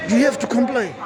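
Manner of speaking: a hammed-up bossy police voice; imitating the stern cop. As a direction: ape the officer barking an order. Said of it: "So he's like".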